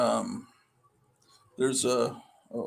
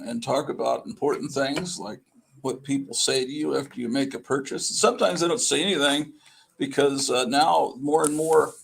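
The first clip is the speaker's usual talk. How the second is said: ordinary talk, nothing marked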